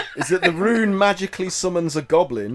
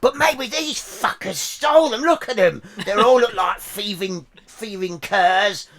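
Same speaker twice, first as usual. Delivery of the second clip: rude goblin voice. Acted. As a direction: spit insults like a spiteful goblin. Goblin insults the party.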